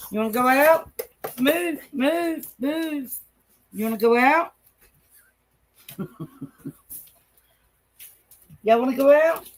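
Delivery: excited dog talk, questions lifting at the end; riling up dogs. Real, not acted.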